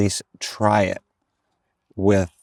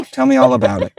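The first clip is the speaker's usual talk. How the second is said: higher, louder put-on voice of the hairdresser; mock hairdresser voice; 4 semitones higher, 7 dB louder, faster than usual. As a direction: imitate the chatty hairdresser, pitched up. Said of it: "her to respond back to me and go".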